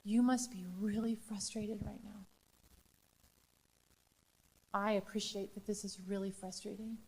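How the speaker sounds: put-on hushed, gentle words to an upset child; quiet soothing whisper to a frustrated student; soft whisper. Shown as a demonstration. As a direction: whisper it calmly and kindly, crouched at their level. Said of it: "and I'm gonna say".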